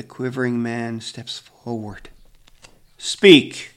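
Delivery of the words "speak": stern command. A narrator's reading. Grandfather orders the accused.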